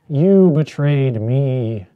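hammed-up betrayal, dragged out heavily; slow mock betrayal. Shown as a demonstration. Second take of the dramatic line, very slow and heavy. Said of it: "All right, that's pretty good".

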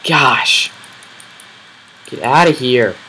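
loud, sharp yell at a mob; startled yell; alarmed shout. Real, not acted. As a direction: shout it away in panic. Mob attacking him.

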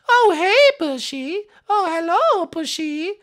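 very high falsetto sing-song; mock-sweet greeting. Gamer greeting Bushy while placing TNT, falsetto mock greeting. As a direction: greet your victim in a squeaky put-on voice.

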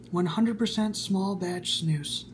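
ordinary talk, nothing marked

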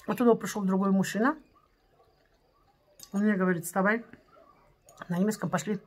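speaking between bites of food; talking while chewing rice. Real, not acted. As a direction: mouth full of rice. Seen 1: rice forked into mouth at 0.2-1.6, then chewing with lips closed.

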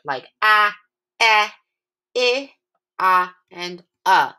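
"ah", "eh", "I", "ah", "uh" each said short and clear; "ah, eh, I, ah, uh" crisp and separate; short vowel sounds. Shown as a demonstration. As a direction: model the short vowels one by one. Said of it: "that vowel sound is usually short".

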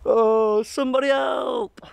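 high, half-joking shout for help; mock cry for help. Kayaker stuck by a swan, calling out in a mock shout. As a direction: call out for rescue, half laughing.

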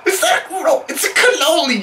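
high, squeaky correction; exasperated high voice. Acted.

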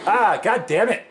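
high, loud cursing outburst; frustrated yell. Real, not acted. Player fails in the game, frustrated yell. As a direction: curse loudly at a botched attempt.

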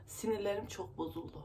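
real upset, fighting not to cry; subdued, nerves frayed, close to tears; holding back tears. Real, not acted. Vlogger telling viewers her nerves are wrecked, holding back tears. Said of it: "Ağlamak istemiyorum artık".

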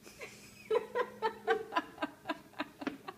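high, pulsing laugh; real giggle fit; helpless laughter. Real, not acted. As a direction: laugh uncontrollably in bursts. Laughs at cats getting wet.

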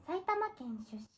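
sexy voice. Pretend. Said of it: "セクシーに自己紹介".

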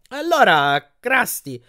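high, casual-sounding opener; forced carefree. A narrator's reading. Percy stalls the giant salesman, forcing a carefree tone. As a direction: keep it light and high, as if unworried. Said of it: "cercando di mantenere un tono spensierato".

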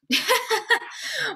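real laugh, high and loud; high burst of laughter. Real, not acted. Teacher laughing at the singer's smug boast, high and loud.

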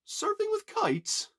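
high, drawn-out puzzled question; pondering. A narrator's reading. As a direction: wonder aloud, slowly, pitch soaring. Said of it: "he pondered".

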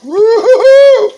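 slow, very high, loud whoop; long joyful whoop. Real, not acted. Cook cheering for the frying fish, joyful whoop. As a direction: stretch out a celebratory woo-hoo. Excited cooking reaction.

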